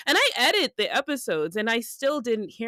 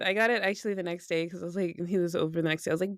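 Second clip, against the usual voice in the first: normal talking voice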